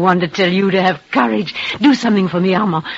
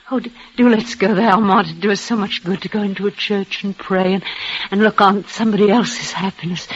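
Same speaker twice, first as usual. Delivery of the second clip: ordinary delivery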